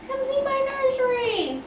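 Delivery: put-on high, sing-song baby voice; baby voice for the nursery. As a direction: pretend the baby is talking, way up high. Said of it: "that was you talking".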